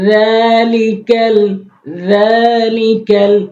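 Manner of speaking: slow chanted tajweed recitation, long vowels; slow Quran recitation. Shown as a demonstration. Teacher demonstrates the verse to students, slow chant. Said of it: "এরপরে হচ্ছে".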